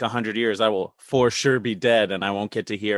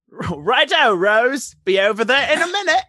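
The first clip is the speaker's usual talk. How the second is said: higher, louder, hammed-up chirpy British accent; slipping British accent; 12 semitones higher, 5 dB louder than usual. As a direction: let the British accent pop out cheerfully. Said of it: "they didn't fix his accent".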